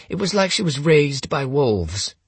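high, strangled sincerity; mimicking his brother. Gunman quotes his brother about Holmes, wicked mimicry. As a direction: mimic an earnest, doubtful man in a high pitch. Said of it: "Lucian was a wicked mimic".

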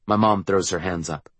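plain reading voice

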